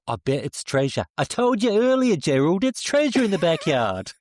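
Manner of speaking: high, loud, hammed-up eager neighbour; mock excitement. Resident telling Gerald about backyard treasure, mock excited. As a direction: act thrilled, pitch leaping up as it goes.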